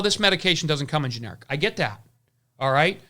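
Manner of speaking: ordinary talk, nothing marked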